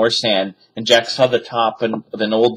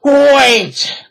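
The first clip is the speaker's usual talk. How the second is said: much higher, loud call; cried out; 7 dB louder than usual. A narrator's reading. Captain calls to rowing pirates, loud cry. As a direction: yell it after the boat. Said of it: "cried Captain Bones".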